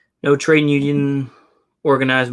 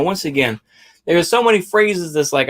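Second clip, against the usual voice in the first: usual speaking voice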